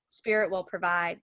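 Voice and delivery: ordinary talk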